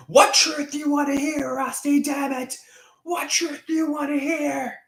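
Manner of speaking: high, strained raving as a lunatic; hammed-up conspiracy freak-out; mock madman. Mock-frantic reply to chatter.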